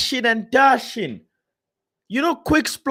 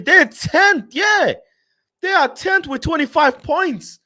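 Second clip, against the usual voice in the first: exasperated outburst; 6 semitones higher than usual. Real, not acted. Streamer to his chat, shouting in disbelief at Chelsea's position. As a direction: blurt it out in disbelief.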